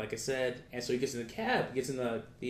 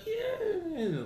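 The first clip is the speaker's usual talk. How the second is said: very high drawn-out mock "yeah"; noncommittal yeah; 4 dB quieter than usual. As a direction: imitate an evasive answer, squeaky high and stretched. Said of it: "not even, like, a real answer".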